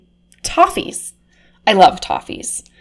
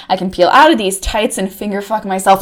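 ordinary talk, nothing marked

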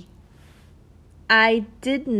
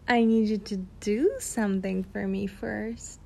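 ordinary delivery

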